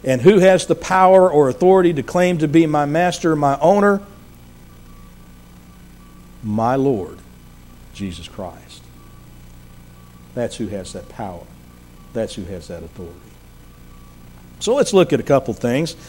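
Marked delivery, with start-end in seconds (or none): 6.4-13.1 low, soft and measured, with long pauses; hushed answer. Real, not acted. Preacher answering his own question to the congregation, hushed. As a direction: drop right down, pausing between phrases.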